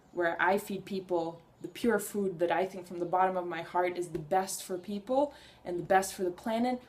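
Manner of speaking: ordinary talk, nothing marked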